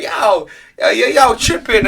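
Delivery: imitated American accent, lifting in pitch. Fan imitating the contestant he finds funny, American accent. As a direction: call it out in a mock American accent. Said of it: "just hearing the American accent is like".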